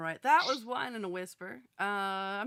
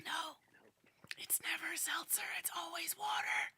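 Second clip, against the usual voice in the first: insistent whisper, pitched higher; emphatic whisper; 7 semitones higher, 8 dB quieter than usual. Shown as a demonstration. Host whispering an insistent correction. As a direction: whisper it firmly, pushing upward. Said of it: "does it have to be in a whisper".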